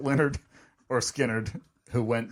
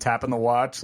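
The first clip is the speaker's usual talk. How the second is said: normal talking voice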